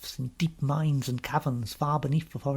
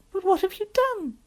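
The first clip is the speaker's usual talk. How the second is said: put-on very high child's voice; questioning little girl; 3 dB louder than usual. Lucy asking the faun his crime, high and concerned. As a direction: ask it as an anxious girl.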